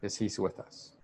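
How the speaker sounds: hushed but stressed "he's with us"; emphasis on with us. Shown as a demonstration. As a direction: press gently on "he's with us". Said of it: "The emphasis here I wanted to make".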